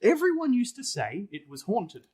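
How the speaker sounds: measured storybook reading voice; story narration. Acted. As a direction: read it like an old ghost tale, unhurried. Reading the ghost story aloud.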